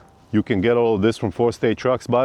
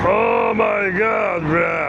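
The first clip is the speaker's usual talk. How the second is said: drawn-out high excited shout; big hype exclamation; hyped up; 11 semitones higher, much slower than usual. Real, not acted. Hyped intro before install.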